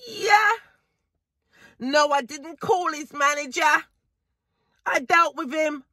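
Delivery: ordinary delivery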